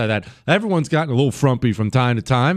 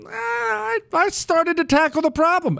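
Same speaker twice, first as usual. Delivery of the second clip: much higher put-on voice, pleased with itself; smug mock voice. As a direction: squeak it out like a proud complainer. Mock self-satisfied complainer.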